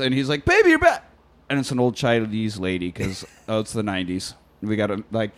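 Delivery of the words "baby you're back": very high mock-delighted cry; excited boyfriend. Boyfriend when the apartment door opens, excited. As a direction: squeal it in imitated joy.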